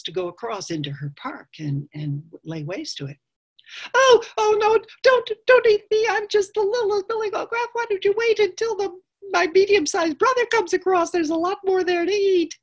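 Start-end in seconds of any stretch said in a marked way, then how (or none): 3.9-12.6 very high, panicky little goat; littlest goat pleading. Acted. Littlest goat begging not to be eaten, shrieky and high. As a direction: squeal a loud, desperate plea.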